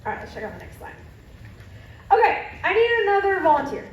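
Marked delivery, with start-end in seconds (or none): 2.1-3.9 calling out. Real, not acted. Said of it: "calling for volunteers".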